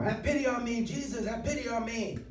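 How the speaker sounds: high-pitched acted cry, held back in volume; desperate screamed plea. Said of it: "he screamed even more".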